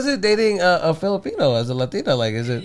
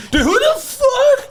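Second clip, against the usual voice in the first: outraged DJ; 3 dB louder, slower than usual. Pretend. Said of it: "move the knobs on my freaking DJ equipment".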